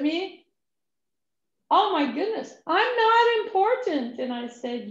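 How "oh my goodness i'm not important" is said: flustered surprise. Retold or imitated. Man reading her title card.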